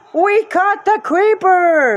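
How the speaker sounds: loud, high, slow cheer; delighted yell. Acted. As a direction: shout it in triumph. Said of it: "Maisie yelled with delight".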